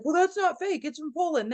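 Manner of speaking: high-pitched mimicked protest; mock objection.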